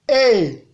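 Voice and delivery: Et from mid pitch, easing down. Shown as a demonstration. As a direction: say Et on a middle tone. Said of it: "Ton moyen". (« Et » au ton moyen)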